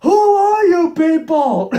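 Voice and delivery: high, loud, slow dopey cartoon voice; Patrick quote. Reviewer quoting Patrick's famous line in a dopey cartoon voice. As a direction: bellow it like a clueless starfish.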